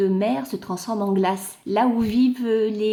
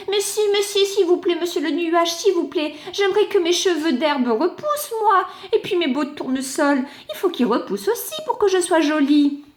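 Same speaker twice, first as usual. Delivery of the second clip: higher, sweet pleading voice; pleading clod; 6 semitones higher than usual. Acted. The clod pleading with the cloud to stay pretty. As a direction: wheedle the cloud with please.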